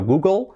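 Google with overdone final vowel; English Google, slightly overdone; exaggerated English pronunciation. Shown as a demonstration. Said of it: "Incluso estoy exagerando demasiado".

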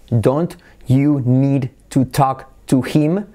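very slow, each word separate; DON'T YOU NEED TO TALK TO HIM with every word fully sounded. Shown as a demonstration. Teacher shows the unlinked form, slow and separate. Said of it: "se convierte en".

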